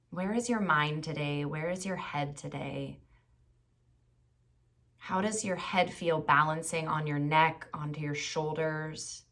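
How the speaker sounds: calm body-scan voice with long pauses; guided meditation. Acted. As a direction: guide them gently through the body, unhurried.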